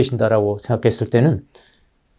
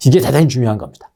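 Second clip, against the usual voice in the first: normal talking voice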